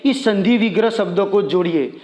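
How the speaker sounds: ordinary talk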